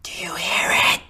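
theatrical loud whisper; stage whisper. Acted. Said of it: "Stage whisper, buddy".

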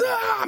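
high sudden startled mom scream; a short yelp of fright as the car swerves. Retold or imitated.

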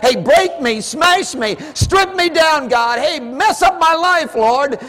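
high, loud fervent pleading; full-force preaching prayer. Real, not acted. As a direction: pour it all out to God at the top of your voice.

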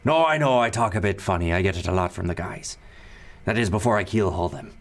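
put-on cheerful threat in a pirate accent; pirate lord joking about keelhauling his crew, pirate accent. Pirate lord voiced in scene.

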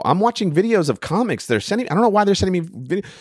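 quick high-pitched befuddled reply; bewildered explaining. Retold or imitated. Retelling his answer to Jenny.

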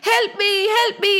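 high-pitched storybook plea; mock tearful cry; crying for help. A narrator's reading. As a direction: call out for help, acting distressed. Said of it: "Kamdhenu started crying".

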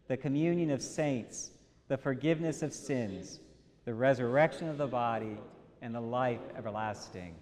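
steady communal recitation; creed recited in unison. Acted. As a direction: recite the creed together, evenly. Congregation recites Apostles' Creed.

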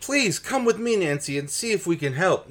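high, loud, laughing urgency; hammed-up panicked plea; mock frantic yell. Bess urging Nancy to come inside, mock frantic yell.

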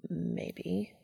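evasive, quiet maybe; cagey. A narrator's reading. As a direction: dodge the question softly.

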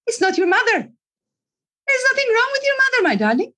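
high-pitched retold insistence; acted emphatic remonstrance; exasperated coach. Retold scolding of a client.

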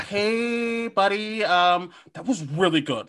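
gently steer the boss after his speech; buttering someone up before the awkward note; tactful PR person. Acted. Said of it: "he's got, like, a P R person pulling him aside".

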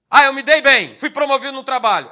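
loud, mock-elated announcement; comemoração encenada. Acted drinker celebrating good news. (empolgado, encenando uma boa notícia em voz alta)

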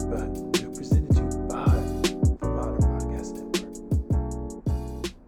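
voiceless rasp, no voice left; raspy whisper. Real, not acted. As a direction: whisper it, voice completely gone. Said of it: "I have no voice".

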